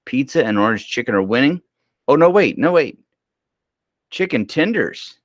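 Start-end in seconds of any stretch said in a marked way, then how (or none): 2.1-5.2 surprised. Real, not acted. Poll result suddenly changes.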